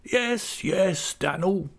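very high plaintive voice; forlorn widow. A narrator's reading. Missus Gummidge to Dan'l, high forlorn whine. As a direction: moan it in self-pity.

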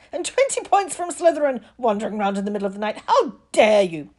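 loud, scolding teacher's outburst; outraged shout. A narrator's reading. Teacher punishes pupil for wandering at night, shouting. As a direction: scold at full volume, stretching the last words. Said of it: "she shouted".

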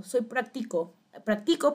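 "práctico" dicho "practíco", acento en la i; leído sin tilde. Retold or imitated. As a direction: carga la segunda sílaba de "práctico": practíco. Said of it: "porque no tiene tilde tampoco". ("práctico" leído tal cual, practíco)

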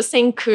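senku ending on K with a nearly silent A; muted final vowel. Shown as a demonstration. Dubber showing the workaround name, senku with a mute ending. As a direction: barely voice the last vowel of senku. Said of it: "assim, quase um A mudo".

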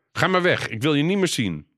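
high-pitched, upset scolding; angry dismissal. Acted. Hurt mother sends her daughter off, angry.

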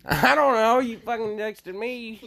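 high, loud outburst that drops off; exasperated retort. Acted. Snapping back at whoever texted, exasperated and high. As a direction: yell back in mock exasperation. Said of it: "And I'm like".

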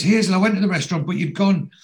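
high, loud, quick acted plea; protesting excuse. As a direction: plead your case in a hurry. Barry pleading in doorstep quarrel.

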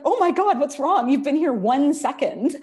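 high, loud worried surprise; alarmed therapist. Retold or imitated. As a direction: gasp at the sudden tears. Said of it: "And she's like".

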